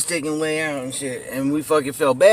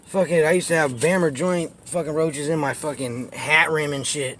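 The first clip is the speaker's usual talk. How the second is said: ordinary delivery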